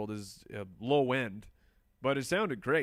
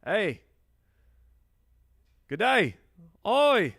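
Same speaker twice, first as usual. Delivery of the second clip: high, loud mock-Aussie calls; badly faked Australian, pitched up and loud; bad Australian accent; 8 semitones higher, 8 dB louder, slower than usual. Shown as a demonstration. Host testing out an Australian for viewers, bad Australian accent. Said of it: "How do I do an Australian?".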